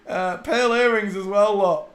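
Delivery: high squealing laugh spilling into loud words; laughing through it. Real, not acted. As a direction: giggle while pointing out the earrings. Laughing at the pearls.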